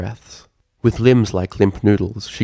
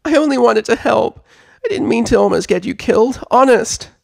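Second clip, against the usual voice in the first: high, loud, tearful filly plea; upset little filly pleading; teary pleading; 6 dB louder than usual. Acted. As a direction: beg forgiveness through sniffles.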